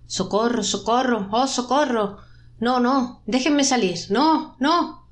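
mounting pleas, rising to high cries; shrieking for help. A narrator's reading. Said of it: "la voz chilla en las profundas". (chillidos de auxilio cada vez más agudos)

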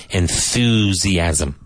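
careful teacher model of Enthusiasm; slow clear model word. Shown as a demonstration. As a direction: stretch out Enthusiasm, th as in thin, s voiced like z. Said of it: "be careful of your T H here".

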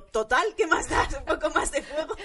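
joking about father lighting a cigarette by the fire; laughing mock shrug. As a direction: toss it off in laughing irony.

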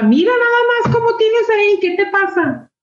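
reclamo agudo e imitado, mucho más alto; queja de mamá. Retold or imitated. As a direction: imitar a la mamá que regaña por el cuarto sucio. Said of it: "Como en la queja". (madre regañando al hijo por el desorden, con queja aguda)

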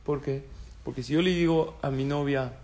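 usual speaking voice

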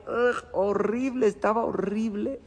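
slow, high groan of disgust. Retold or imitated. Rabbi tasting the spoiled chicken, slow disgusted groan. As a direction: drag it out like tasting something rotten.

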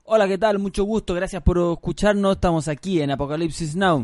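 high, punched-up radio host greeting; show intro. Pretend. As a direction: open the show with a big announcer hello. Last take of show intro. (presentación enérgica de locutor)

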